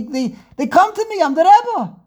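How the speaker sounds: swelling pride bursting out; excited boast. Acted. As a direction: build up and crow it. Building excited Rebbe boast.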